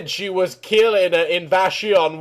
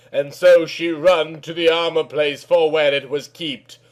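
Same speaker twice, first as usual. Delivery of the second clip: usual speaking voice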